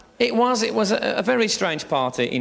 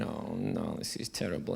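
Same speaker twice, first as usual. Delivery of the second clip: gloomy inner voice; 11 semitones lower, 12 dB quieter than usual. Retold or imitated. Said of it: "You're sitting there thinking".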